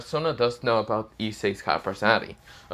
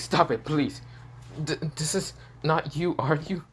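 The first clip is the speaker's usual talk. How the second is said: scared pleading; 6 semitones higher than usual. A narrator's reading. Girl begs Issei to stop, mildly scared. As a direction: plead with a mild, halting fear. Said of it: "This is where she's scared".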